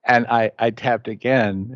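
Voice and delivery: ordinary talk, nothing marked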